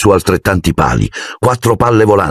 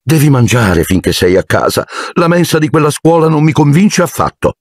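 high, fussing mother's voice; fussy aunt; 8 semitones higher than usual. Acted. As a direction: coo over her big son. Aunt Petunia coddles son. (mamma apprensiva, voce alta e affettata)

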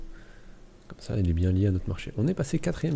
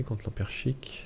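whisper; 2 semitones higher, 7 dB quieter, much slower than usual. Real, not acted. Reading an event under his breath.

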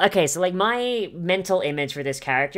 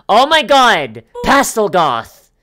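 loud, slow excited outburst; 6 semitones higher, 11 dB louder, slower than usual. Real, not acted. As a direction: burst out with sudden delight. Excited discovery of a trait.

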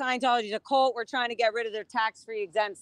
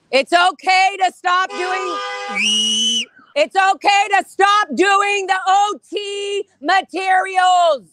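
loud, high, slow slogan; protest shout; 6 semitones higher, 6 dB louder, slower than usual. Real, not acted. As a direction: call it out so it carries across the lot.

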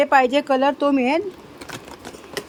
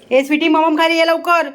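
calling the dog; 4 semitones higher, 6 dB louder than usual. Real, not acted. Calling dog to come eat.

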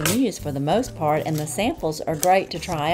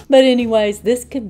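a loud, high burst of laughter into the words; laughing; 7 semitones higher, 10 dB louder than usual. Real, not acted. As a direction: laugh at yourself, then push on. Laughing at own southern French.

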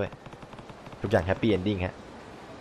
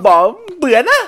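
put-on much higher, louder girlish voice; girl's voice; 8 dB louder than usual. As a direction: squeak it up as the angry daughter. Dubbing the girl Kyoko. (เสียงเด็กผู้หญิงแหลมสูงโวยวาย)

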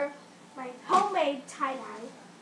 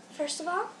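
ordinary delivery

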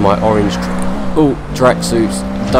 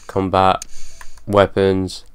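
ordinary talk, nothing marked

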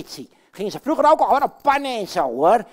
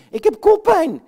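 complaining; 9 semitones higher, 7 dB louder than usual. Acted. Katrijn repeats headache complaint.

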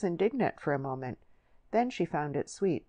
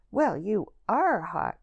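slow, louder and higher mock-concern jab; spiteful jab; 5 semitones higher, 6 dB louder, slower than usual. A narrator's reading. Lily venting her anger on newly arrived Lucy, spiteful. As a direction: lean on it, glad to have someone to scold. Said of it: "welcoming a victim for her anger".

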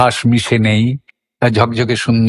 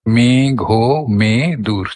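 মেঘমেদুর slowly split as me-gho-me-dur; syllable by syllable; much slower than usual. Shown as a demonstration. Teacher counting syllables for learners, syllable by syllable. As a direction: count out the four syllables of মেঘমেদুর, stretching each. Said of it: "আমাদের কটা syllable হয়?".

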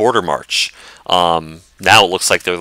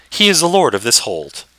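higher, courtly lady, glad and gracious; noble lady voice; 7 semitones higher than usual. Acted. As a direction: play the lady of the keep, warmly welcoming.

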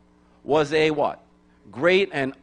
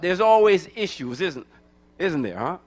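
ordinary talk, nothing marked

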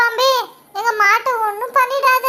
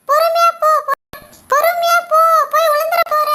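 calling out a warning; 6 semitones higher than usual. Acted. (குழந்தையை எச்சரிக்கும் உரத்த குரல்)

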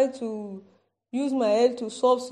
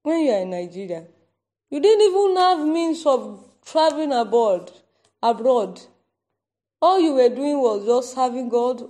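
loud, fervent sermon; preaching at full force; 6 dB louder than usual. Acted. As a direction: preach it out to the church.

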